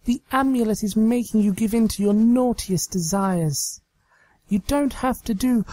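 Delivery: high, pleading pony voice; Trixie, pleading. Acted. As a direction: plead with the possessed friend.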